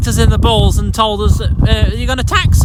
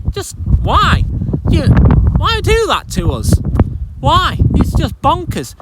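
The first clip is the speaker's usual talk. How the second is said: high, incredulous pleading why; exasperated why; 8 semitones higher than usual. Real, not acted. Farmer demanding why from the prime minister, exasperated. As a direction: ask why in real disbelief.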